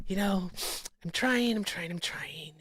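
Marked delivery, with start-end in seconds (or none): 1.6-2.5 whisper. Real, not acted. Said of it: "whispered aside".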